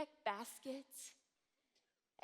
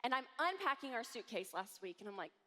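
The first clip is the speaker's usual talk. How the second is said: ordinary delivery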